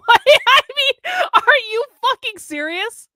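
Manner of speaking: high, loud disbelieving laugh-outburst; laughing in disbelief, way up high; incredulous. Real, not acted. To viewers about Rufo's tweet, high incredulous disbelief.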